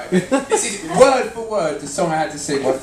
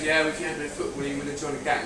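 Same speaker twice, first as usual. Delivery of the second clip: soft, quick, simpering agreement; pathetic nodding agreement; 8 dB quieter, faster than usual. Retold or imitated. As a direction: murmur it, nodding along. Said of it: "interviewer always nods in pathetically, goes".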